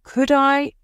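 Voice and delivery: high, hesitant, faltering request; shy girl's trembling request to her uncle; voice shaking a little. Acted. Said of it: "her voice shook a little".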